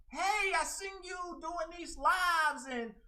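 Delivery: high, loud, slow gushing voice; mock flattering fan. Streamer imitating a fake fan's gushing praise, high and loud.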